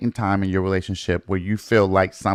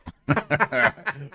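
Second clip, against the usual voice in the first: laughing; 4 semitones higher, 4 dB quieter than usual. Real, not acted. Laughing at friend's Harry Potter seeker joke.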